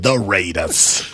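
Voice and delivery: deep, slow drawn-out announcer call; Chris Berman impression. Checking his Berman impression with the co-host, low and slow. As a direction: draw out the Raiders low and heavy. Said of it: "Does Chris Berman say it?".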